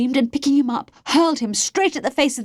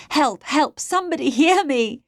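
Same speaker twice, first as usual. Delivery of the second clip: high, urgent cry for help; 7 semitones higher, 4 dB louder than usual. A narrator's reading. Held-down girl calls for anyone to hear, crying out. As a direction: call out desperately, pitch raised. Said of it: "stopping her from crying out".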